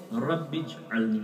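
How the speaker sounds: رب اجعلنی slow and soft, رب kept apart from اجعلنی; correct Arabic pronunciation. Shown as a demonstration. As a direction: recite رب اجعلنی carefully, slowly. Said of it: "صحیح تلفظ یہ ہے". (رب اجعلنی صحیح تلفظ، آہستہ اور صاف)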